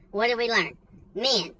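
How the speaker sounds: ordinary delivery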